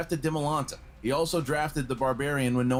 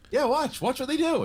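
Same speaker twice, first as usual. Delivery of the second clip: much higher, cocky quote; quoting Coy; 13 semitones higher, 3 dB louder than usual. Retold or imitated. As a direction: toss it off like a cocky manager. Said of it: "And he says".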